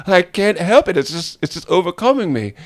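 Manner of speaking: high, loud helpless protest; put-on accent voice. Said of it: "let me use this voice".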